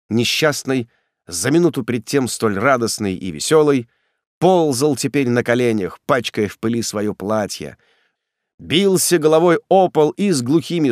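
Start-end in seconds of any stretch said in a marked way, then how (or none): none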